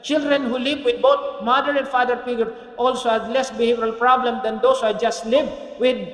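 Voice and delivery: loud, driving sermon voice; full-force preaching. Acted. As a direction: preach it hard to the congregation. Preaching climax.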